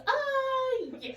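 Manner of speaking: put-on high, loud, slow elderly-man voice; aphasia patient. Nurse retells patient ordering breakfast, high slow mock old man. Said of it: "He goes".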